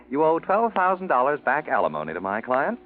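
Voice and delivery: usual speaking voice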